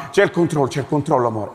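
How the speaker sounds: ordinary talk, nothing marked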